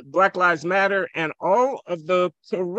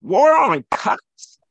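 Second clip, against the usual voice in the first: slow, louder, incredulous "War on cops?"; scornful echo; 5 semitones higher, 6 dB louder, much slower than usual. Real, not acted. Mocking the phrase before the host cuts in, slow scornful disbelief. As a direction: drag out "War on cops?" as if it were absurd.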